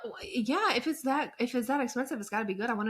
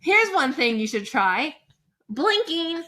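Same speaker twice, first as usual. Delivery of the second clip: mock all-caps comment; 6 semitones higher, 7 dB louder than usual. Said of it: "You were blinking in all caps".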